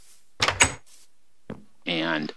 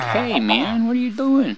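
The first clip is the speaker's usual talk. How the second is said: much higher, startled villager voice; 13 semitones higher than usual. Retold or imitated. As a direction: squeal it like a spooked villager. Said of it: "He's like".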